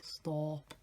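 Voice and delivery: lightly imitated Scottish accent; a rounded, rough attempt at Scottish. Vlogger mimicking a TikTok guy, shaky Scottish accent. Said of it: "That's Scottish".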